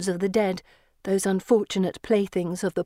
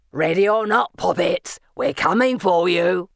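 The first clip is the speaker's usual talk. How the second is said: taunting villain; 2 semitones lower than usual. A narrator's reading. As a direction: call out like a menacing hide-and-seek. Asriel taunting the hiding girls.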